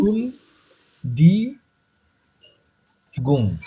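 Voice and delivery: "শুল", "ডি", "গুম" each alone, long pauses between; syllable by syllable. Shown as a demonstration. Teacher leading student through a hard word, "শুল, ডি, গুম" syllable by syllable. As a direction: dictate "শুল... ডি... গুম" one syllable at a time. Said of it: "syllable ভাগ ভাগ করে নেবেন এরকম".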